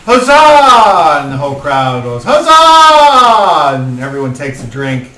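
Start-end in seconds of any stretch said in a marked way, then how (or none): 0.0-1.2 high, loud festive shout; guard's cheer. Acted. 2.2-3.8 high, loud crowd roar; the whole hall shouts it; crowd cheer. Acted.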